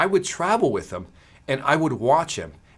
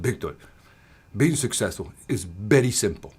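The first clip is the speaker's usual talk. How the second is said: put-on much lower, softer Spanish-accented mentor voice; Jose Santana's Spanish accent; 6 semitones lower, 3 dB quieter than usual. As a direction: imitate a Spanish mentor sharing his wisdom. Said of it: "this deep, beautiful Spanish accent".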